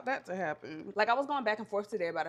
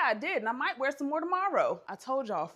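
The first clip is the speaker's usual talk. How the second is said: ordinary delivery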